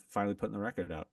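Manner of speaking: normal talking voice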